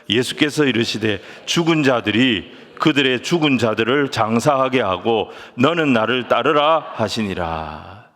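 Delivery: loud, measured reading aloud; scripture reading. Acted. Pastor leading everyone in reading verse twenty-two, loud scripture reading. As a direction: read the verse out firmly, drawing out the close.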